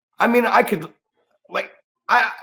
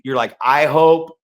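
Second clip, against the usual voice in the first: ordinary talk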